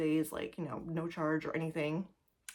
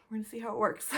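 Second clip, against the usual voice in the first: whispering; 4 semitones higher, much faster than usual. Real, not acted. Whispered aside about guest bed.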